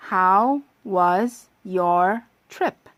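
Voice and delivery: very slow, stretched How was your trip; slow practice read. Shown as a demonstration. 여행 질문을 아주 천천히 읽어 주는 선생님. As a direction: lay each word out slowly for the class. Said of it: "자, 발음해 볼까요?".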